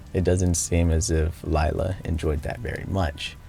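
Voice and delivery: steady recap narration voice; story narration. Acted. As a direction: narrate the game's ending evenly.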